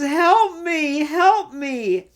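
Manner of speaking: hammed-up feeble cry for help; pleading Ariana. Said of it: "And she says".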